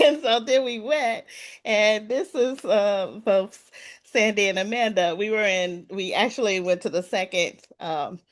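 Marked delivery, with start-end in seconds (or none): none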